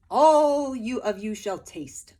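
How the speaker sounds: loud, slow proclamation; grand soldier announcement. A narrator's reading. Soldier announcing the feast, slow and loud. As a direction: proclaim it slowly. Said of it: "the soldier said".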